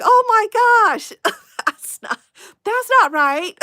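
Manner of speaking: hammed-up dismay at the word; shocked realization. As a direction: replay the moment she learned the meaning. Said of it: "and I'm like".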